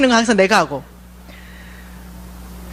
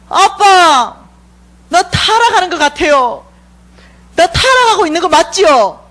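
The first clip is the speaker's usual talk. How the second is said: put-on higher, pleading little-girl voice; childlike confession; 6 semitones higher than usual. A believer confessing to God, higher and pleading.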